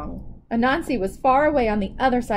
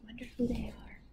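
whisper; 14 dB quieter, much faster than usual. Real, not acted. Reader wonders about the pictured people, whispering. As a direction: whisper it like a secret. Aside to listeners.

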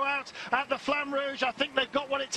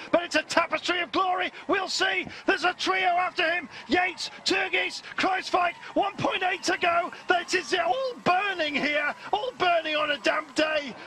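shouting race call; 5 semitones higher than usual. Acted. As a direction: call the finish at full throttle.

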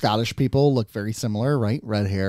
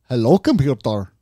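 low, rolling Scottish "Hello, computer"; Scottish accent; 4 semitones lower than usual. Shown as a demonstration. Scottish accent to the listeners, showing how it should sound. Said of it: "That's Scottish".

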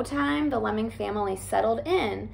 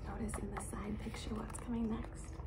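whispered aside; 16 dB quieter, faster than usual. Real, not acted.

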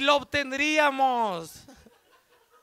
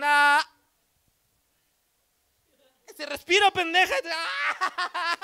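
mock wailing; 7 semitones higher, 3 dB louder, much slower than usual. Child's crying and mother yelling.

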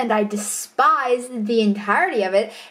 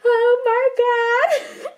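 high, slow, laughing groan; laughing oh my god; 12 semitones higher, much slower than usual. Real, not acted. Vlogger catching her own slip, slow laughing groan. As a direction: drag it out, laughing at yourself.

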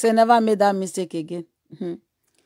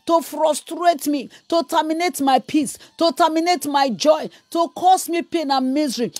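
raised, pounding preaching; fiery deliverance prayer; 6 semitones higher than usual. Acted. Preacher commanding fire on enemies, fervent shouting prayer. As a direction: hammer each line like a prayer warrior.

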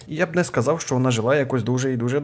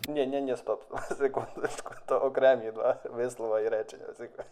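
quiet, giggling backtrack; laughing correction; 6 dB quieter than usual. Real, not acted. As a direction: chuckle and correct yourself softly. (тихо, зі сміхом виправляється)

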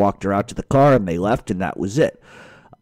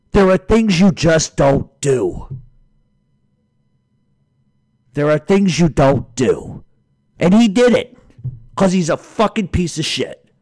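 slow, higher-pitched angry condemnation; real simmering outrage; 6 semitones higher than usual. Real, not acted. Ranter condemning the man who crossed a line, enraged. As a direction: lay down the line, word by word. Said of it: "I'm so fucking enraged".

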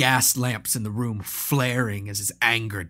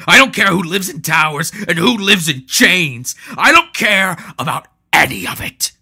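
louder, higher, hard-driven fury; furious; 8 semitones higher, 13 dB louder than usual. Acted. Maul raging at the brothers, louder and higher. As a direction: spit out every I don't care.

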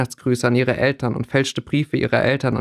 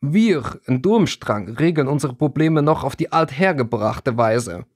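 put-on thick Durmstrang accent with irritation; irritated Durmstrang accent. A narrator's reading. Vasili doubting Aiden's courage, irritated Durmstrang accent. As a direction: goad him in a heavy Durmstrang accent. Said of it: "klang inzwischen ziemlich gereizt".

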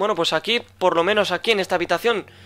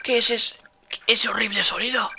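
acted alarm; 6 semitones higher, 3 dB quieter than usual. Character hears monster eating someone.

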